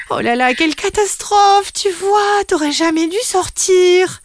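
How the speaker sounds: high, loud fretful lament; fretting mother. Acted. Mother kangaroo frets at her son, high fretting lament. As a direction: wail it like a worried mother.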